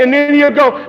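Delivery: ordinary talk, nothing marked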